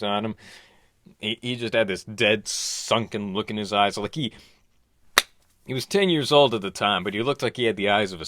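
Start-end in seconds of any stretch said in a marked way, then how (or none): none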